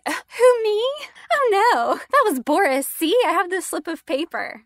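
high, loud mock-innocent protest; feigned innocence. Acting out feigned innocence to accuser.

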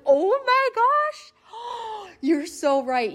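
high, drawn-out gasp of delighted surprise; mock gasping oh my gosh, very high and slow; laughing realization. Telling her husband he caught her, with a high delighted gasp. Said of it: "I start breaking out laughing".

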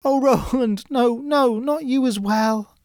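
high, pleading cry of sorrow; sad cry. Acted. Sister cries to Roland, sad high cry. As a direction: cry out his name in sorrow. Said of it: "she cried out sadly".

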